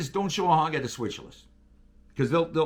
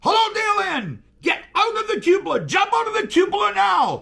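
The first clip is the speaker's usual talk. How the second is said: high, loud, urgent yell; panicked engineer yelling into the handset; retold shout; 13 semitones higher, 5 dB louder than usual. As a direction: scream the order to jump. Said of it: "he grabs the handset and he goes".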